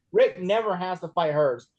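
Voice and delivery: normal talking voice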